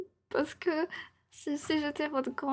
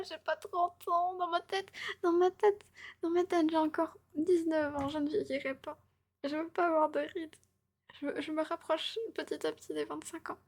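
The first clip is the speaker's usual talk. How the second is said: high-pitched, pleading wail that fades; mock tearful whining; 6 semitones higher than usual. Streamer begging chat not to age her, mock tearful whine. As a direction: protest like a hammed-up crybaby.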